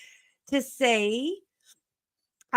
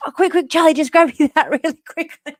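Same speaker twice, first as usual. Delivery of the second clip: louder, urgent calling; hurried call; 4 semitones higher, 8 dB louder, faster than usual. Retold or imitated. Mum retelling hurrying Charlie to fetch the cable, urgent call. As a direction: hurry the kid along. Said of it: "I was like".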